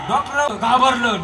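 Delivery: ordinary talk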